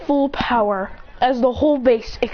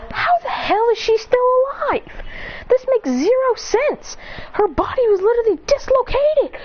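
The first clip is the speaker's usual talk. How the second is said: high-pitched, incredulous outburst; astonished onlooker, voice leaping up; acted disbelief; 12 semitones higher than usual. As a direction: exclaim it in high-voiced shock. Shocked onlooker finds victim alive.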